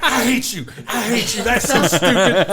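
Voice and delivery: high, laughing fake outrage; laughing mock anger. Coworker groaning at the dad joke, laughing mock outrage. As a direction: groan it through a laugh, pretending to hate him. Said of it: "she was so mad".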